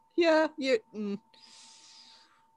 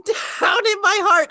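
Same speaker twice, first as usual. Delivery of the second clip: slow, high, laughing sung reply; laughing singsong; 8 semitones higher, 3 dB louder than usual. Pretend. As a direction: sing it while cracking up. Song call and response.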